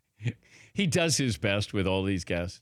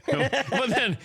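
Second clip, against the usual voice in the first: high laughing words through giggles; 9 semitones higher than usual. Real, not acted. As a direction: laugh it out, amused. Host laughs at unplugged phone.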